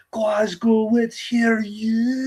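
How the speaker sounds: high, drawn-out hammed-up Scottish accent; Humza Yousaf. Politician calling to a Glasgow crowd, exaggerated Scottish accent. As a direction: stretch it out like a Scottish crowd rouser. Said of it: "it's the clip where Hamza Yusuf is like".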